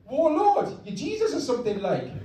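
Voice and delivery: high Geordie incredulity; Luke Skywalker. Acted. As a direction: question it as a baffled Geordie son. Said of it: "I made him Geordie".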